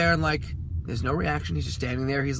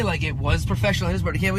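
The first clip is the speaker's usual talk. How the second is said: ordinary talk, nothing marked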